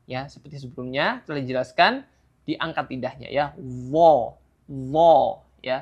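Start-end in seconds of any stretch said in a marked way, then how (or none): 3.6-5.2 dha thick, tongue raised; emphatic letter demo; heavy dha. Shown as a demonstration. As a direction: fat dha from the tongue tip. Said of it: "Jadi harus ditebalkan".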